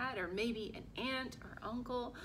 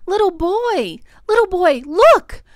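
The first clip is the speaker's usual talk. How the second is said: high, very loud call, last words drawn out; shouting; 10 semitones higher, 25 dB louder, slower than usual. A narrator's reading. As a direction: holler excitedly across a crowd. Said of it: "woman shouts".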